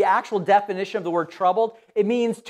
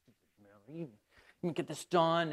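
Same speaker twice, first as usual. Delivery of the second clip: much softer, lower resentful mutter; under her breath; 6 semitones lower, 11 dB quieter than usual. Acted. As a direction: grumble it so Mary can't hear. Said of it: "Probably peeking over there like".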